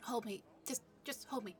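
usual speaking voice

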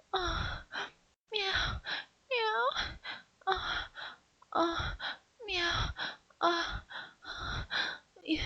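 high, soft, mewling meows; catgirl mewing. Acted. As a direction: moan like a pleased kitten. Catgirl moaning with pleasure.

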